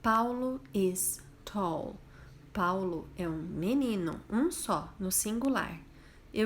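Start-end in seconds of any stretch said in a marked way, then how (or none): 0.0-2.0 very slow, deliberate reading; slow model sentence. Shown as a demonstration. Teacher reading example one very slowly. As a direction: stretch it out for the learners. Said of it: "Vamos ver a número um".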